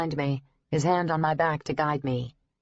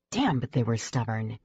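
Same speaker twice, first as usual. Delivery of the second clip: plain reading voice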